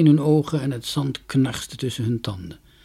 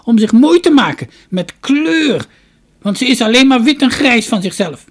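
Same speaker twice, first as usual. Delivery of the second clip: put-on high, loud, creaky old woman's voice; shrill-pitched storybook crone, full voice; 13 semitones higher, 11 dB louder than usual. A narrator's reading. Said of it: "zei de oude vrouw".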